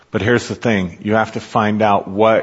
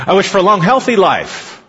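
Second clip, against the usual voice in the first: higher, louder, eager wish; 8 semitones higher, 4 dB louder than usual. Retold or imitated. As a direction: blurt the wish out excitedly.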